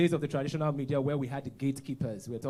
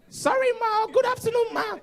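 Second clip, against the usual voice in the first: much higher, louder apologetic shout; 9 dB louder than usual. Retold or imitated. Caller's guest shouting his apology, laughing loud. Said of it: "Please, don't shout".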